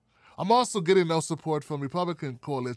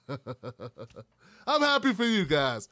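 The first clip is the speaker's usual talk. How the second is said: laughing; 5 semitones higher, much slower than usual. Real, not acted. Laughing about no work week.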